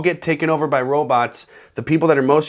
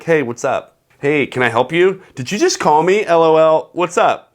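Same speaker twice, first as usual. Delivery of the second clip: eager texting voice; 3 semitones higher, 5 dB louder than usual. Retold or imitated. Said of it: "they're like".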